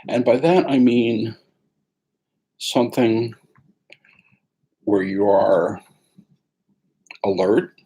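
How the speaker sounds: calm meditation guide, long pauses between phrases; guided meditation. Acted. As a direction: ease them gently into the sitting posture.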